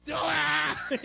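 sudden loud, high barked order; bossy parent shouting an order at his son; mock shout. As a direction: yell it like a bossy parent forcing a toddler. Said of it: "than just be saying".

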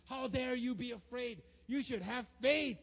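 slow, loud, stern rebuke; a harsh God scolding the fearful; mock scolding. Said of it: "He doesn't say".

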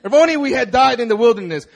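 loud, high, laughing mock lament; melodramatic. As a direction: ham up the despair like a soap opera. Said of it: "this sounds so melodramatic".

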